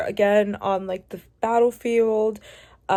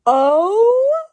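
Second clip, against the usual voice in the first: much higher, louder stunned oh; shocked gasp; 9 semitones higher, 9 dB louder than usual. Real, not acted. Reader stunned by a character coming out, gasping. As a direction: let the twist knock the breath out.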